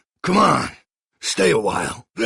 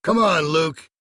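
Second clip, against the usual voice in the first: ordinary talk, nothing marked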